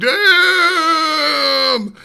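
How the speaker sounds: long-drawn, high, loud cry; stunned exclamation. Retold or imitated. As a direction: let the word ring out high and loud. Acted stunned reaction in story.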